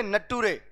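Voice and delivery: नटूरे said as "na-too-re", nature read as spelled; deliberate misreading; mispronounced nature. Shown as a demonstration. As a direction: sound out nature letter by letter as नटूरे. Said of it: "नेचर को बोलते हैं नटूरे".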